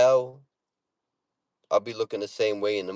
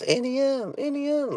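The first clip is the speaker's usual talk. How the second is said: high-pitched mock Dorothy calling out; thin, high parody of a film line; Auntie Em cry. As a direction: squeal it way up high, movie imitation. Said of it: "Like fucking Wizard of Oz".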